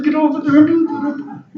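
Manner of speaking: high, loud yelled order; screaming voice. Retold or imitated. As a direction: bark it like an impatient shouting boss. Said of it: "screaming and yelling at you".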